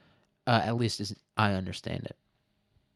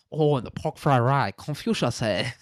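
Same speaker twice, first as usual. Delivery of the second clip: mock Chinese accent; 3 semitones higher than usual. Showing what peak comedy is, mock Chinese accent. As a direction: imitate a caricatured Chinese accent. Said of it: "like, Chinese accent".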